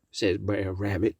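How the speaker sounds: ordinary delivery, nothing marked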